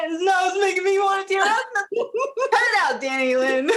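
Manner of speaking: high, teary laughing through emotion; laughing to hold back tears; tearing up. Real, not acted. Moved guest laughing through tears at the host tearing up. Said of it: "I can see you starting to tear up".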